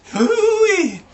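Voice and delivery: very high, loud whoop; whooping shout. Real, not acted. Reader whooping at the silly story, loud. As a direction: whoop it out, delighted.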